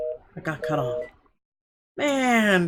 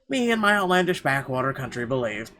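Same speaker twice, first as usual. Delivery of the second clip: plain reading voice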